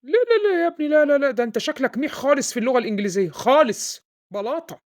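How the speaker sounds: high, loud dismissive scolding. Acted. Father loudly scolding his son over English.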